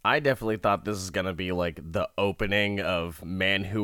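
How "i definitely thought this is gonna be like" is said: usual speaking voice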